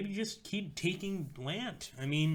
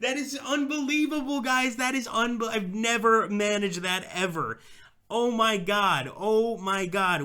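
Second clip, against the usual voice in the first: ecstatic shouting; 10 semitones higher, 7 dB louder than usual. Real, not acted. Winning the Spartacus campaign at last.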